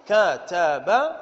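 كتب stretched slowly into ka-ta-ba; syllable-by-syllable demonstration. Shown as a demonstration. Teacher weighing the example word, كتب slowly as ka-ta-ba. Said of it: "إذا هذه طريقة الميزان".

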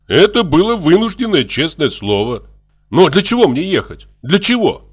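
high, loud pleading argument; exasperated protest. Acted. Doctor held back from leaving, exasperated protest. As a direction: climb in pitch to the last question. Doctor arguing against going.

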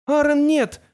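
loud, very high cry of warning; frightened scream. A narrator's reading. Girl warns her friend away, screaming. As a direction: cry out to stop him, high and urgent. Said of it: "закричала Тамара".

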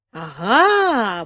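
gleeful aha, as if he caught him out; triumphant exclaim. A narrator's reading. Old man catching the captain out, triumphant aha. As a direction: crow in triumph. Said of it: "воскликнул старик с торжеством".

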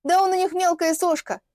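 high, emphatic dismissal with falling end; scornful put-down. Acted. Girl belittles Вадим to his client, scornfully. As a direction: belittle him as a МЕЛКАЯ СОШКА, as a statement.

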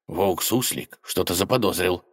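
usual speaking voice

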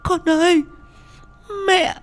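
high, loud desperate wailing; a terrified mother crying out to her son; crying scream. Acted. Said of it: "Cô Lan hét lên / Vừa nói cô vừa khóc".

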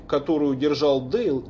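usual speaking voice

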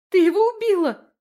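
very high, loud squeal of accusation; shriek. A narrator's reading. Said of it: "взвизгнула Лерка".

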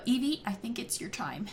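breathy whisper to the character. Acted. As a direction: whisper it like a secret nudge.

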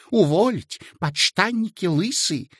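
high, imitated bellowing boss; mock yell. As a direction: ham up the boss's yelling. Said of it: "Орёт".

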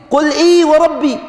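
إيه said high and ringing, falling at the end; stress on إيه. Shown as a demonstration. Teacher reciting the verse to his class, stressing إيه. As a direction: lean hard on إيه before وربي. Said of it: "في القرآن".

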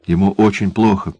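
ordinary delivery, nothing marked

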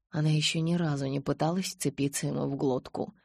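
plain reading voice

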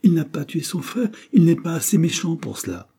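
high, forceful protest; emphatic. A narrator's reading. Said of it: "répéta mademoiselle avec emphase".

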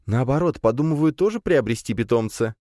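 put-on young woman's voice. A narrator's reading. Nadya speaking.

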